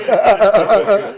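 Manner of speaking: loud, slow laughing words; laughing hard. Real, not acted. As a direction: laugh through the scary memory. Bird scare retold, laughing.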